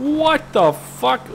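very high, drawn-out disbelief; incredulous outburst. Retold or imitated. Reacting to cruel comments, high drawn-out incredulity. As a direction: stretch it out slowly, appalled.

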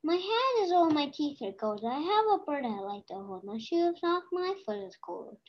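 quick, singsong rhyme reading; brisk patter with bouncing pitch; fast recital. Real, not acted. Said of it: "I like saying it fast".

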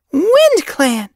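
very high, loud outburst; exclaimed. A narrator's reading. Apprentice hears a rival clan is trespassing, exclaiming. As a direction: cry it out, high and outraged. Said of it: "Foxpaw exclaimed".

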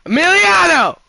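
loud, high shout of his name; calling out. Real, not acted. Streamer yelling for Emiliano in another room, loud call. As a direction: call him from across the house.

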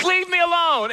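high, exasperated brush-off; hammed-up annoyed plea; mock irritation. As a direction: play-act shooing off a pestering fan. Snapping at fan who recognizes him.

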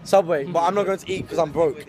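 ordinary delivery

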